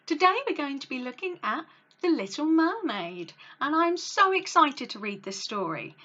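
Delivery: ordinary delivery, nothing marked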